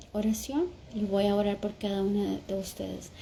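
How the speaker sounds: normal talking voice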